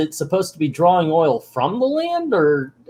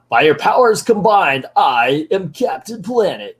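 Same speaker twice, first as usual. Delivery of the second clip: mock superhero proclamation, pitched up; Captain Planet; 4 semitones higher, 3 dB louder than usual. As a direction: announce yourself like a cartoon hero, louder at first. Superhero announces himself, acted out.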